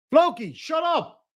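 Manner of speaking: high, slow shout at the dog; yelling at the dog. Real, not acted.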